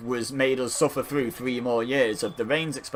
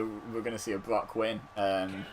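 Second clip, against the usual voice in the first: ordinary talk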